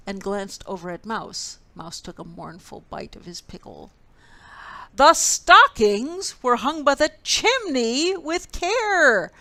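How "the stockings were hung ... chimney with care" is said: much louder, higher declaiming; louder recital. A narrator's reading. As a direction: boom the line at Mouse. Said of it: "Bear repeated more loudly".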